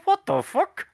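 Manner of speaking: very high, squeaky disbelief; a stunned squeal from backstage; mock baffled outrage. Brandon backstage watching himself get buried, squeaky outraged disbelief. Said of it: "Brandon probably backstage going".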